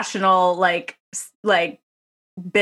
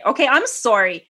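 normal talking voice

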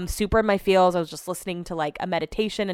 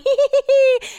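squeaky high hee-hee giggle, much louder; mock girly giggle; 7 dB louder than usual. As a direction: giggle like an emoji-happy millennial texter. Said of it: "the millennials are using all the emojis".

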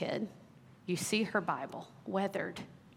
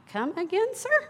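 much higher, incredulous and laughing; mock indignation; 12 semitones higher, 4 dB louder, slower than usual. Teen indignantly answering her dad, mock outraged. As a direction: squeak it out in disbelief. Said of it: "And I'm like".

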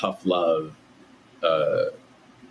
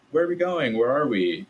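imitating a small child; 8 semitones higher than usual. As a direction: play the impatient toddler in the back seat. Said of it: "my son asked this all the time".

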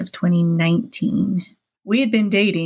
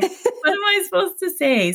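high-pitched retold panic; helpless, pitched-up dither; freaking out; 11 semitones higher than usual. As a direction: reenact being totally at a loss. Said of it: "I was really freaking out at that point".

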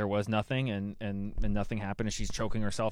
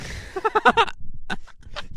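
high laugh; 6 dB louder than usual. Real, not acted. Laughing at the impression.

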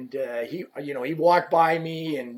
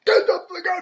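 very high, strained mock scream; imitated screaming; 14 semitones higher, 3 dB louder than usual. Player chasing the rep out of the room, imitated screaming. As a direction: scream it as the raging player throwing someone out. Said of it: "he's screaming at me".

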